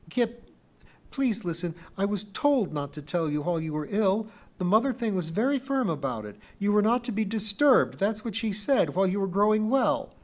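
put-on a young girl urging Kip to listen; pleading little girl. A narrator's reading. Pee-wee explains the secret to Kip, high and urgent.

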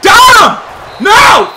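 excited shout. Real, not acted. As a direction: yell at the play. Tackle then big mistake.

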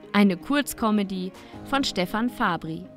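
normal talking voice

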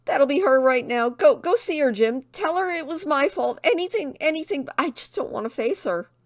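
high, panicky, tumbling plea; frantic pleading. Acted. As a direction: beg a friend in rising desperation. Tristan dreads facing the owner.

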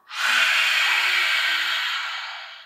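slow audible exhale; long breath out. Shown as a demonstration. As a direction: blow the air out long. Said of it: "Breathe out through your mouth".

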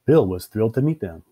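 ordinary delivery, nothing marked

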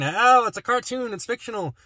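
high, mocking dismissal of cartoons; mock dismissive voice. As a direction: sneer it like a know-it-all. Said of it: "If I put it in a cartoon".

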